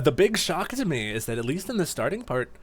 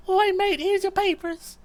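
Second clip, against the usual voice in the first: shrill high squeaky Cockney parody, laughing through it; mock Cockney schoolboy squeal; overdone Cockney; 3 dB louder than usual. Joking how Duskull shouldn't sound, squeaky Cockney parody. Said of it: "young British, but not like a".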